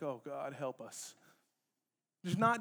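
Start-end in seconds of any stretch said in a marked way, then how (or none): 0.0-1.1 low, hushed mock prayer; acted parental dismay under the breath; despairing parent. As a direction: sink into a quiet, resigned plea. Parent reacting to teenager's answer.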